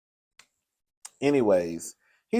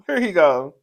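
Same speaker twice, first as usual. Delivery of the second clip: ordinary talk, nothing marked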